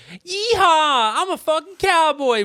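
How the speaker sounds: loud high yeehaw cowboy whoop; mock cowboy yell.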